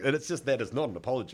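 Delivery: normal talking voice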